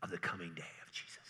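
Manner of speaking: hushed conspiratorial sales voice; false prophet sales pitch. Retold or imitated. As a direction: whisper it like a huckster selling fear. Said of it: "if I say".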